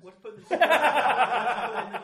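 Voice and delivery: loud laughing through words. Real, not acted. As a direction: giggle through the joke. Laughing joke.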